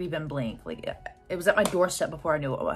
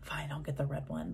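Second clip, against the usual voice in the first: resigned whisper; 3 semitones lower, 8 dB quieter than usual. Retold or imitated. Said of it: "So I was like".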